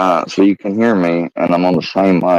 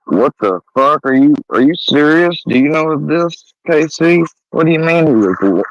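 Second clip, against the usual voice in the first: shocked; 7 semitones higher than usual. Real, not acted. Shocked by news on stream.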